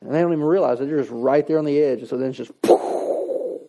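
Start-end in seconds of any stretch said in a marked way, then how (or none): 2.6-3.6 breathy burst of air, imitating a blow-up; explosive poof. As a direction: puff it out like something exploding. Imitates someone snapping.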